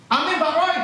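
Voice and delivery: high, rising prompt for an amen; calling on someone. Real, not acted. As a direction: call across to a churchgoer for a response. Memanggil jemaat agar menjawab amin. (memanggil jemaat dengan nada tinggi)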